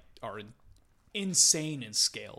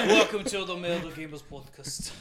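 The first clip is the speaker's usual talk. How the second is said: giggly, higher podcast welcome; laughing; 4 semitones higher than usual. Real, not acted. Laughing mock intro amid accent bit.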